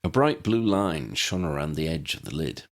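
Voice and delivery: plain reading voice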